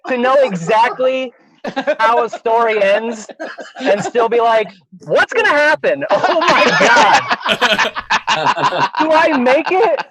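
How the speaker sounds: high, loud, laughing mock panic; giggling fake terror at your own story. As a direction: squeal it through laughter like you're scared. Panicked high self-quote, laughing.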